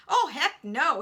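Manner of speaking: loud, hearty denial; declaring. Acted. Unhurt man reassures boy, loud declaration. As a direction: declare it boldly, waving worry away. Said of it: "he declared".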